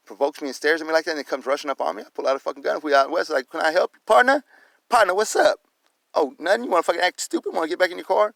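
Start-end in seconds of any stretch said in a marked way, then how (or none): 3.5-5.6 high, loud acted challenge; confrontational call-out. Acts out confronting the man, out west. 6.1-8.3 higher-pitched acted goading; mocking taunt. Acted taunt at backed-down man.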